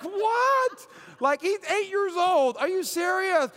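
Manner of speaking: high, loud incredulous outburst; stunned parent, hammed up; mock disbelief. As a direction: react as if you can't believe the news. Acts out shocked reaction to diagnosis.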